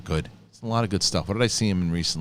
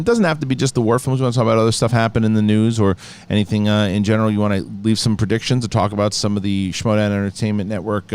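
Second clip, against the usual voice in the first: ordinary talk, nothing marked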